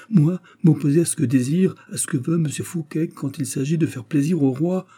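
high, agitated protest; alarmed protest. A narrator's reading. Tailor denies opposing the king, alarmed. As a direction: protest in a panic. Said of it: "s'écria le tailleur épouvanté".

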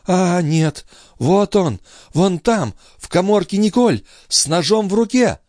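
very high woman's character voice; high falling statement, as if seeing it; entranced sister, alarmed. Acted. Sister tells brother what she sees in the closet, high and alarmed.

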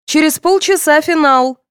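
high, loud, monotone announcement; wheezy radio voice. A narrator's reading. Radio calling contestants to dressing room, wheezy announcement. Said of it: "просипело радио".

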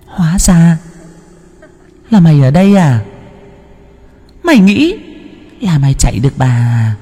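high, eerie ghost-woman voice, gloating; a vengeful spirit savoring her catch; ghost woman's taunt. Acted. Ghost woman taunts the sleeping boy, high eerie gloating. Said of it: "người đàn bà cười lên khanh khách".

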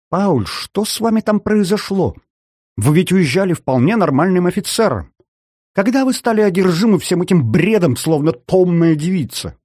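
incredulous rebuke; exasperated. Acted. Officer scolds a colleague.

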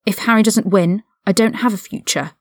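ordinary delivery, nothing marked

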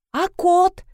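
high-pitched indignant question; acted indignation, voice jumping up. A narrator's reading. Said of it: "возмутилась Рина".

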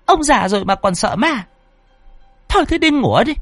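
high-pitched nagging wife; annoyed scolding. Acted. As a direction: scold the husband irritably in a high voice. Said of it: "nghe chồng nói như vậy thì bực mình". (bà vợ bực mình càu nhàu, giọng cao)

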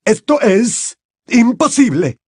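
high, loud and slow, faltering; stammered disbelief. Acted. Mr Crouch stammering at his elf, stunned. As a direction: stumble over the words in shock. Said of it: "balbuceó".

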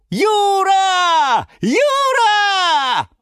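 very high, loud, drawn-out cheers; joyful shouting. A narrator's reading. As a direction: squeal the name with glee. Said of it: "закричали и запрыгали все сразу".

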